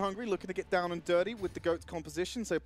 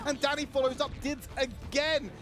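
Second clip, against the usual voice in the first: excited, higher-pitched esports cast; live play-by-play; 7 semitones higher than usual. Real, not acted. Caster calling a final fight to viewers, excited play-by-play. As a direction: call the fight with rising intensity.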